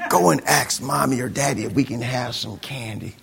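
put-on soft, low, wheedling kids; coaxing sibling. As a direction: talk the little one into asking, softly. Older kids coaxing smallest child.

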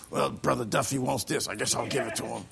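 a soft, grudging sigh of a giver; grudging mutter. Pretend. Reluctant church member muttering softly. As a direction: mumble it like you resent giving.

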